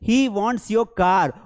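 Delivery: he wants money said very slowly, word by word; slow dictation. Shown as a demonstration. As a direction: spell it out slowly for the class. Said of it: "उसे तुम्हारी कार चाहिए". (धीरे-धीरे बोलकर सिखाना)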